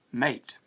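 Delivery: "mate" with a long AY vowel; American vowel. Shown as a demonstration. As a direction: say "mate" as the middle of American "tomatoes". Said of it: "middle syllable of tomatoes pronounced".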